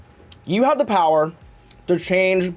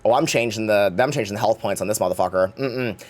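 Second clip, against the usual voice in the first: lower, sneaky DM muttering behind the screen; sly dungeon master; 9 semitones lower than usual. Pretend. Dungeon master behind the screen, lower and sly. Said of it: "sit behind your little D M screen and go".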